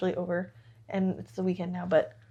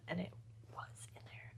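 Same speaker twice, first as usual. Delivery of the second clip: whisper; 2 semitones lower, 20 dB quieter than usual. Real, not acted. Amazed aside, whispered.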